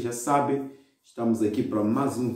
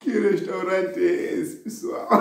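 laughing; 8 semitones higher, slower than usual. Real, not acted. Laughing at the sketch.

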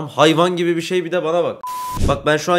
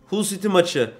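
ordinary delivery

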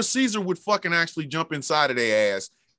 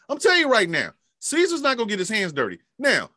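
ordinary talk, nothing marked

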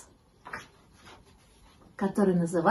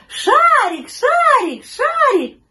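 very high, loud calling; shrieky kids' chant, high and loud; children shouting; 9 dB louder, much slower than usual. A narrator's reading. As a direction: call out like running children. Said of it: "Дети бежали и кричали".

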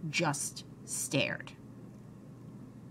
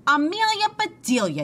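raised, higher-pitched yell; storybook yell for someone in the house; 9 semitones higher, 5 dB louder than usual. A narrator's reading. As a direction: call the name out loudly, pitched up. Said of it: "yelled Mister Rogers".

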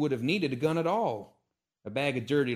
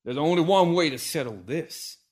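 hissed; 6 semitones higher, 9 dB louder than usual. Acted. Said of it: "he hissed".

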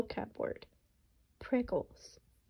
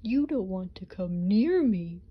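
exclaiming raccoon; 4 dB louder than usual. Acted. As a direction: warn him off like an embarrassed raccoon. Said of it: "he exclaimed".

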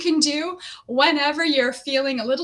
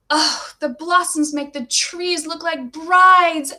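slow, louder, higher wonder; awed delight; 4 semitones higher, 7 dB louder, slower than usual. A narrator's reading. Dreamy girl gazing at blossoming trees, slow awed delight. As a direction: marvel at the blossoms.

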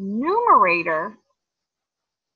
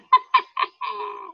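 high witchy cackle; witch cackle; 11 semitones higher than usual. Shown as a demonstration. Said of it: "I want you to cackle again".

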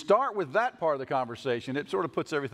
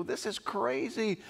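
higher, slow, softer muttered disbelief; mock dismay; 7 semitones higher, 4 dB quieter, slower than usual. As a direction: sigh it out like you've given up on the world. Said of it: "and you just go".

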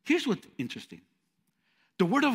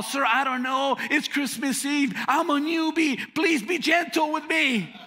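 higher, pleading newbie; mock pleading; 10 semitones higher than usual. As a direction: beg the customer to go easy. Newbie pleading with angry customer.

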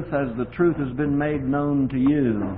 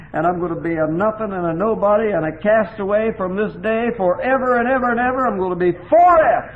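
building higher and louder to a cry; rising lament; 7 semitones higher, 5 dB louder than usual. Retold or imitated. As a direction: climb into an anguished outcry. Crying out to the Lord.

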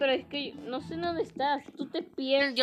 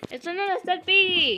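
calling out; 7 semitones higher, 9 dB louder than usual. Real, not acted. Calling out location.

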